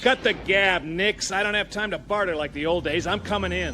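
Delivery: no time to argue, push through it; impatient. Acted. Cutting off a stalling friend.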